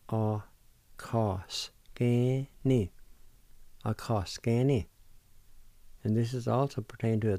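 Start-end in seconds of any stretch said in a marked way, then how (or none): 0.1-2.9 "A ka s ke ni" said very slowly and evenly; slow demo. Shown as a demonstration. Teacher to radio listeners, slow careful pronunciation. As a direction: give learners "A ka s ke ni" one slow sound at a time. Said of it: "And it's pronounced like this".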